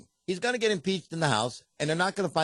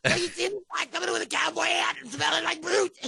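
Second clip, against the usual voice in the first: high squeezed squeaky voice; strangled voice; 13 semitones higher than usual. Retold or imitated. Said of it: "a little squeaky voice like this".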